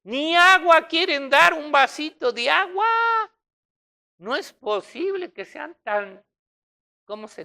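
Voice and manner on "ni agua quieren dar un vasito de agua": loud, high indignant outcry. Real, not acted. Preacher scolding stingy hosts, loud indignation. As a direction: cry out in disbelief at the stinginess.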